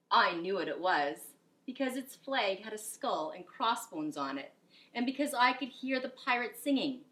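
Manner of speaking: lively storybook narration; animated picture book reading; reading to children. A narrator's reading. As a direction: read it with expression to a class. Reads picture book aloud.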